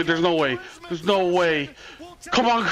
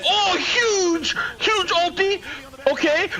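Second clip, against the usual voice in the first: ecstatic cheering; 6 semitones higher than usual. Real, not acted. Streamer cheering his team's huge ultimate, ecstatic shouting. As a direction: scream for the play like a fan.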